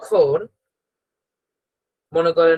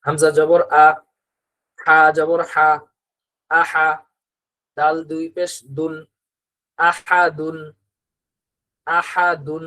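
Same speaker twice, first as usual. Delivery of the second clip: measured reading-practice chant with pauses; teacher leading an Arabic reading drill aloud; recitation drill; slower than usual. Shown as a demonstration. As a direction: spell out each letter so the class can follow. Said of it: "সবাই আমার সাথে সাথে বলবেন".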